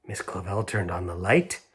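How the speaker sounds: plain reading voice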